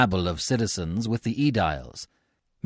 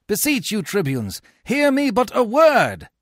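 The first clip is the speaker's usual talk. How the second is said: high, raised-voice plea; urgent beseeching; 13 semitones higher, 5 dB louder than usual. A narrator's reading. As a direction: beg the tribunes to listen. Menenius beseeches the tribunes.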